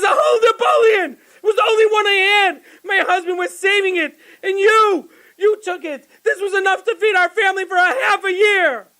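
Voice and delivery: high, fast outraged rant; frantic high-pitched scolding; screaming. Acted. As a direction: wail at the storekeeper in indignation. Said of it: "The, the storekeeper was out of breath, just being screamed at".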